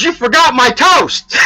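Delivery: high, slow, wailing complaint; husband mad over his missing toast, wailing; outraged punchline. Acted. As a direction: bellow it like a cheated old man. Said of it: "He gets mad".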